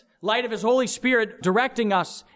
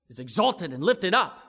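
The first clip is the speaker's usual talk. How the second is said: ordinary delivery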